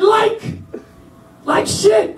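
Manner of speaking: very high, strained blurt; hesitate, then squeeze the answer out high; imitated cornered recruit. Cornered trainee answering sergeant, high strained blurt.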